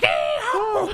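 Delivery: very high, loud cowboy whoop; cowboy yee-haw. Retold or imitated. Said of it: "the three of them are like".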